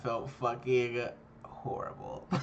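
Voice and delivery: slow, hushed whisper of defeat. Real, not acted. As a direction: drop to a whisper and drag it out. Recalling crushing loss.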